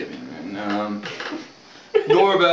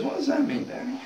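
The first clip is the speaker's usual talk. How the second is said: put-on high, soft little-boy question; 13 semitones higher, 7 dB quieter than usual. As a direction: ask like a puzzled small child, high and quiet. Young boy asks father.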